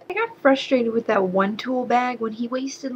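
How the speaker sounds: usual speaking voice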